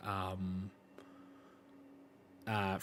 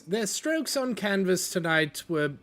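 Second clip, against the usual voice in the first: higher, airy artist voice; artsy Mila; 7 semitones higher than usual. Acted. Mila praises the newcomers' bold mess, higher and airy. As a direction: admire the mess like a painting. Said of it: "Mila then speaks up".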